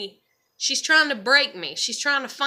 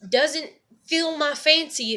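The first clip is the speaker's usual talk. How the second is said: ordinary talk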